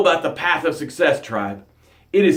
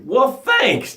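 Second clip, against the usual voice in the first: ordinary talk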